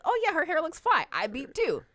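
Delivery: high, loud breezy compliment; casual approval. Acted. Wife admiring another woman's hair, upbeat casual approval. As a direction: toss off an upbeat, approving aside. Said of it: "It'd just be".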